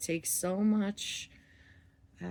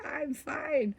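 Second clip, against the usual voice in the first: mock higher I'm fine; 8 semitones higher than usual. Partner deflecting again, higher.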